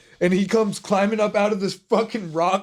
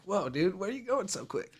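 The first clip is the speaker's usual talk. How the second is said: very soft, rising puzzled quote; a small, hushed call after someone; mock bewilderment; 3 semitones higher, 10 dB quieter than usual. Left behind on the trail by his friend, softly bewildered.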